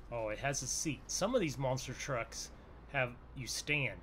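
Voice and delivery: ordinary delivery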